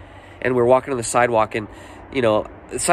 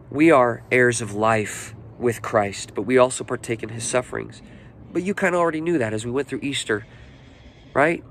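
ordinary talk

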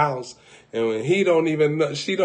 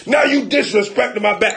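offended protest; 8 semitones higher, 5 dB louder than usual. Acted. Customer offended by chiropractor.

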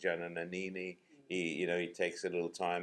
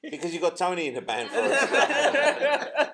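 laughing; 11 semitones higher, 9 dB louder than usual. Real, not acted. Joking about bandmates, laughing.

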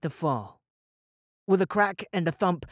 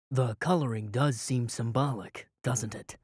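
lower, softer, unbothered calm; a hint of exaggerated calm; overly calm; 5 semitones lower, 4 dB quieter than usual. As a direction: say it as if nothing is wrong. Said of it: "in an overly calm voice".

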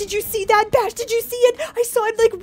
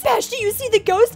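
ordinary talk, nothing marked

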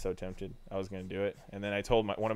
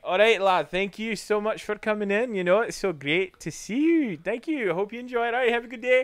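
high, loud, cheery Scottish lad; bouncy Scottish salesman accent; 11 semitones higher, 6 dB louder than usual. Retold or imitated. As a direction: send off a customer in hearty Scottish. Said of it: "I was doing a Scottish accent".

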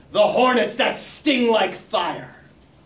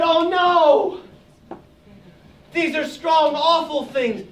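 mock alarm; 7 semitones higher, much slower than usual. Animals panic at the task.

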